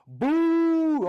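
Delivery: loud mock booing shout; a jeering crowd's loud boo. Congregation booing the preacher.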